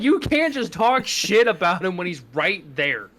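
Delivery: retold shocked telling-off; shocked scolding. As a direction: scold him in disbelief.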